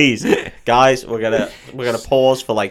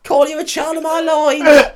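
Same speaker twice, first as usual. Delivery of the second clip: high put-on voice; 4 dB louder than usual.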